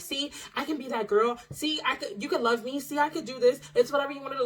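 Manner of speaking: eager to please. Retold or imitated.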